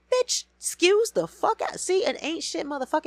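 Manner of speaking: high, loud disbelief; shocked outrage at Kirk's texts; outraged outburst. Real, not acted. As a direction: recoil in outrage.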